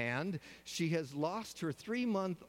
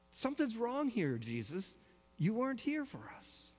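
reproachful Martha; 8 semitones higher than usual. Acted. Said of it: "Can you hear in her voice saying".

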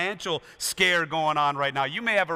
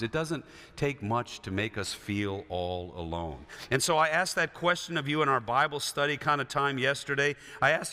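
ordinary talk

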